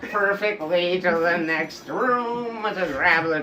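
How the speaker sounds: mock stiff handler. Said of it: "He talked like this".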